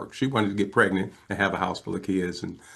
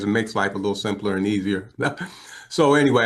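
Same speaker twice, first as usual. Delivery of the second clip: ordinary talk, nothing marked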